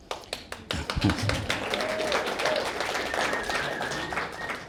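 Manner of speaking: high breathy laugh; overcome, laughing it off; emotional laugh. Real, not acted. Father overcome at toast.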